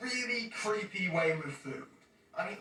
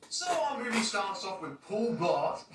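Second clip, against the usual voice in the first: ordinary talk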